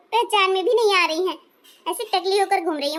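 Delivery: normal talking voice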